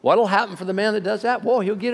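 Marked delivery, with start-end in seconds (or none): none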